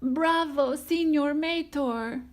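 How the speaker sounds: high, bright cheer; high cheering fan voice; adoring fan. Acted. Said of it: "added Tia".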